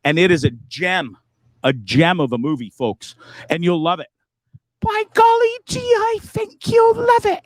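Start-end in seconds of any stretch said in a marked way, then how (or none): 4.8-7.4 much higher, slow, folksy mum impression; mom's voice. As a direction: put on your mother's sweet high voice. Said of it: "I just did this in my mom's voice".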